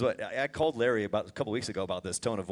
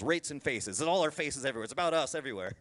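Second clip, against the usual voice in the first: ordinary delivery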